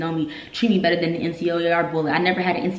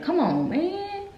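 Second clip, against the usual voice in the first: exasperated disbelief; 9 semitones higher, slower than usual. Real, not acted. Outraged at rank being called.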